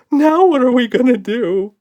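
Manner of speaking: high, loud panicked shriek; frightened creature screaming. A narrator's reading. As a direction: hysterical monster shriek. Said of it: "shrieked Red".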